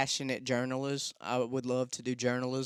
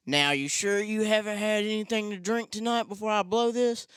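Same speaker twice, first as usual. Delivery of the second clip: higher mock cop voice; imitating the cop; 7 semitones higher than usual. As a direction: put on the cop's suspicious voice, higher.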